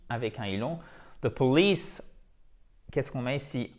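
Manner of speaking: usual speaking voice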